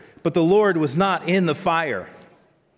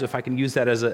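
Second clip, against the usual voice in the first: usual speaking voice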